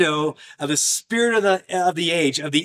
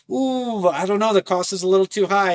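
hesitant whiner; 8 semitones higher, 3 dB louder than usual. Retold or imitated. Voicing hesitant soldier.

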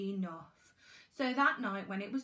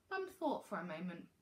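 usual speaking voice

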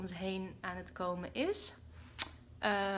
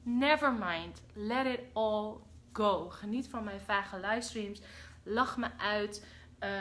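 ordinary talk, nothing marked

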